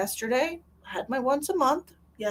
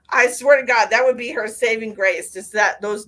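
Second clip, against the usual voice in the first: ordinary talk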